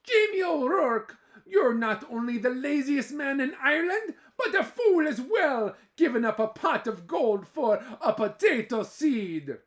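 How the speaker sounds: high, loud scolding; furious wife. Acted. Eileen scolding Jamie for his folly, high and loud. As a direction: tear into your foolish husband. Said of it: "she was furious".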